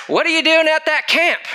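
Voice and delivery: high, pressing question from Bob; incredulous Bob. Retold or imitated. Bob presses Brad on why he came, high and amazed.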